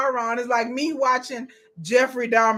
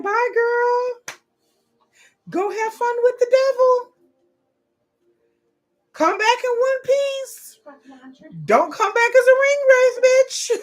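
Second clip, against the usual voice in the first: squeaky high sassy friend, waving her off; hammed-up girly send-off, pitched way up; playful high-pitched goodbye to a friend leaving with Sauron; high mock girlfriend voice; 12 semitones higher, 5 dB louder than usual. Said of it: "They like".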